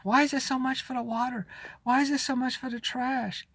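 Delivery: a hint of a whiny complainer; lightly mock-whine the questions; mock whining. Imitating himself whining about the bills. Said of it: "I'm, like, whining".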